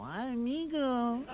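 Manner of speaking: long, drawn-out Mexican "amigo"; Mexican accent. Acted. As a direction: stretch it slowly like a put-on Mexican. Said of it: "Mexicans is always amigo".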